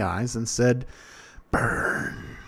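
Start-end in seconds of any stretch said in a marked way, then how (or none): 1.5-2.4 hissed command. A narrator's reading. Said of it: "I pictured him hissing the words".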